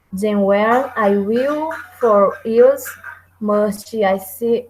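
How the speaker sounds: slow, drawn-out metrical reading; slow classroom verse reading; slow scansion reading. Shown as a demonstration. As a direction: read the line out slowly, beat by beat. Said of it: "Outro exemplo também que eu peguei".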